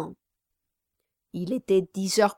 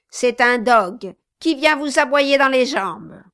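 louder, higher spiteful tirade; Quilp the dwarf, venomous; 4 semitones higher, 5 dB louder than usual. Acted. Quilp denouncing Kit to the Brasses, louder spite. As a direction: pile the insults on with contempt.

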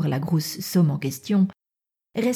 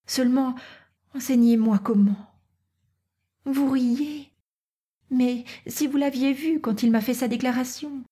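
put-on pleading young Natacha; 7 semitones higher than usual. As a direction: plead lightly, in a higher voice.

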